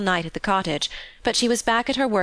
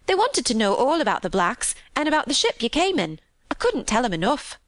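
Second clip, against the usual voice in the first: higher, swooping Yorkshire maid; eager country housemaid; 5 semitones higher than usual. Acted. Maid telling Mary of her family's curiosity, eager. Voices Martha in dialect.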